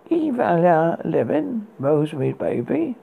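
usual speaking voice

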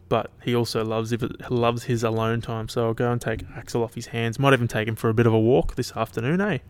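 ordinary delivery

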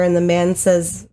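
ordinary delivery

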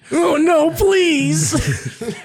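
high-pitched begging cry; pleading shout. Pretend. Playing a pleading toilet.

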